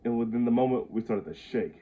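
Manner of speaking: plain reading voice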